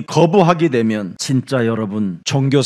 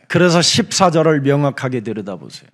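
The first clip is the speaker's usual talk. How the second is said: ordinary talk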